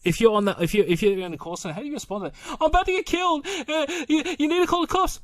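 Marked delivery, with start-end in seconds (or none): 2.6-5.2 high-pitched mock panic; panicked caller. Acting a caller begging the call centre for help, mock panic. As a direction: plead urgently in a much higher voice.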